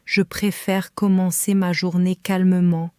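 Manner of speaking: ordinary talk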